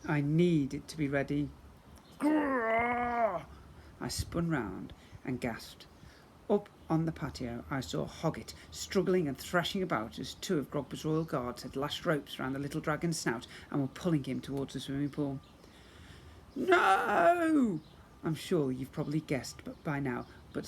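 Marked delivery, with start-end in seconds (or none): 2.2-3.4 high, rolling growl. Acted. Dragon growl. 16.5-17.8 very high loud "No!"; horrified cry. Acted. Hero sees dragon dragged.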